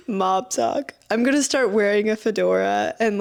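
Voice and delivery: usual speaking voice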